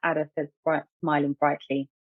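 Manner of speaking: ordinary delivery, nothing marked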